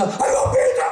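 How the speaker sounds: very high strangled squeal; passionate craving. Retold or imitated. Person offered pizza, squealing with passion. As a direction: squeal with overblown passion for food. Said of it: "They just feel passionately about pizza".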